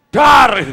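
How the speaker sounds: explosive, high-pitched "dar"; sudden shouted bang. Acted. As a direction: startle them with one very loud burst. Sudden bang after the sleepy act. (teriakan "dar" yang mengagetkan)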